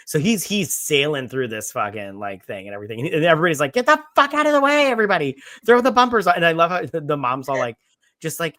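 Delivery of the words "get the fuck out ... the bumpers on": high, loud, fast mock yelling; urgent warning yell. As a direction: holler like a crowd clearing the way. Everybody yelling warnings at incoming boat.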